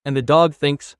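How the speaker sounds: normal talking voice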